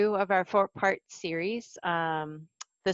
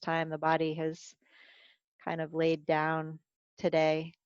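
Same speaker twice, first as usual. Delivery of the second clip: guided meditation. Acted. Teacher guiding a body scan for the class, calm meditation voice. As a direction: ease listeners down into the body, gently.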